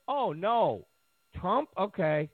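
ordinary talk

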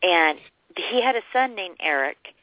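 usual speaking voice

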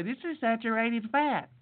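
put-on fussy complainer; mock complaint about the food; mocked objector. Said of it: "and say".

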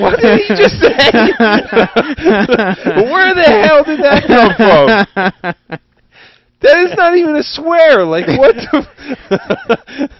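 loud, high-pitched mock outrage through laughter; imitating mom's shocked shriek; hammed-up bewildered yelling, cracking up; retold disbelief. Mother's shocked reaction, acted while laughing.

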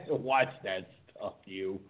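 slow words through a laugh. Real, not acted. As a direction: talk while still laughing. Laughing after the bit.

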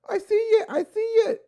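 put-on high, eager little-kid voice; excited little girl. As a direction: squeal it like an excited small child. Little daughter excitedly calling out.

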